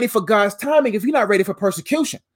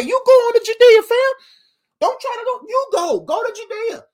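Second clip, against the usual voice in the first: much higher, taunting voice of the brothers; mocking brothers; 10 semitones higher than usual. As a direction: goad him to go, pitched up high. Preacher voices Jesus' brothers taunting.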